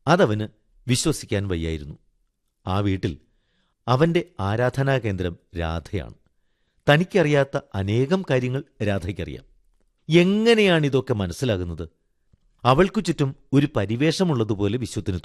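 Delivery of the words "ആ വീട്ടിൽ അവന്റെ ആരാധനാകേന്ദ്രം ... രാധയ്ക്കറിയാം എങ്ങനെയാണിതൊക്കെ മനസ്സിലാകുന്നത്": ordinary delivery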